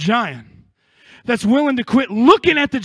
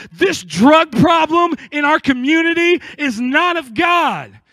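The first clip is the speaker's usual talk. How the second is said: loud, higher proclamation; church declaration; 5 semitones higher, 4 dB louder than usual. Acted. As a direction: shout it over the community. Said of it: "churches to rise up and go".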